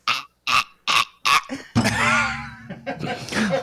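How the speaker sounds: short strangled "ah" bursts, airless; imitated choking gasps. Mother mimics herself choking during the Heimlich, strangled gasps. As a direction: act out gagging for air in separate gasps. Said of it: "I literally choked".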